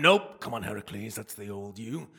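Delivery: ordinary delivery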